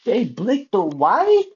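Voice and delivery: shocked disbelief. Real, not acted. Shocked reaction to the footage.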